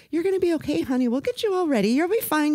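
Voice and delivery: high, sweet soothing voice; reassuring. Retold or imitated. Medical woman calming a crying patient, reassuring. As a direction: imitate a nurse comforting a patient.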